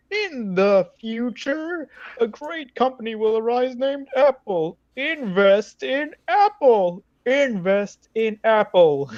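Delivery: put-on high, very slow prophetic old man. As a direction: drag it out like a wise old seer. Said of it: "in the same old man voice goes".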